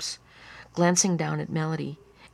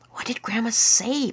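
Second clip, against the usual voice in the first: put-on higher-pitched pleading child; 6 semitones higher than usual. A narrator's reading. Lyle begging Annie to repeat Grandma's words, pleading. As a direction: beg for an answer. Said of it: "pleaded Lyle".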